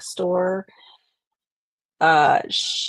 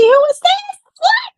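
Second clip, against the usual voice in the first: much higher, squealing with delight; excited greeting; 9 dB louder than usual. Real, not acted. Host greeting a guest on the stream, high thrilled squeal.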